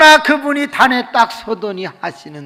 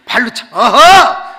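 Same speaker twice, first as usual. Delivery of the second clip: higher, louder slow battle cry; blocking cry; 8 semitones higher, 14 dB louder, slower than usual. Retold or imitated. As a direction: shout as you block each blow. Wife blocks blows.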